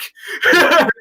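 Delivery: high loud laugh; burst of laughter. Real, not acted. Laughing at the client's ruined tank, loud high laugh. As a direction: cackle at the absurd story.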